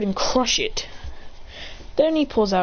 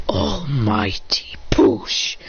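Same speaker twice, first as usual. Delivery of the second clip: whispered technique call; 8 semitones lower, much slower than usual. Retold or imitated. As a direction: whisper the move name very slowly.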